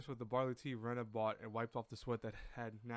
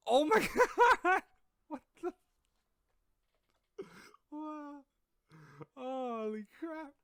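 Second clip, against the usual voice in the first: high-pitched, laughing disbelief; real astonishment, squeaky and giggling; laughing shock; 11 dB louder, slower than usual. Real, not acted. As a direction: burst out in stunned laughter, pitch way up. Shocked by old character art.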